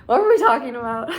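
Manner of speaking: ordinary talk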